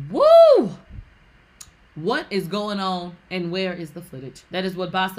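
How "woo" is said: high, loud "woo"; sudden high-pitched exclamation; loud whoop. Real, not acted. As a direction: whoop in reaction. Host reacts to the quoted speech.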